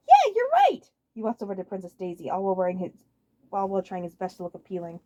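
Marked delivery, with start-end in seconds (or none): 0.1-0.9 very high, loud giddy cry; giddy excitement. Acted. Waluigi thrilled by Wario's advice, very high giddy cry. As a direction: burst with glee. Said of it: "grinned giddily".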